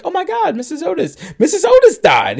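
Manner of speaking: very high, loud, gleeful squeal; hammed-up ecstatic shriek, laughing; happy freak-out. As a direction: freak out with mock joy, voice shooting way up. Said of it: "freaking out in a happy way".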